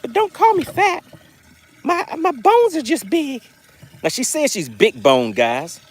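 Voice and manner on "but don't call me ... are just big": high falsetto of a big-boned fish; talking fish. Acted. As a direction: plead the fish's case in a high voice. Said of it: "She's like".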